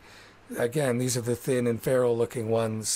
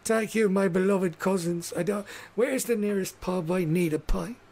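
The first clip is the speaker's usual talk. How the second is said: higher, mock-sweet thanks; cheeky thanks; 6 semitones higher than usual. [name] mocking the halflings as he leaves for the pub, cheeky sarcasm. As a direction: toss it off sarcastically.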